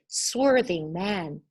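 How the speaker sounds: plain reading voice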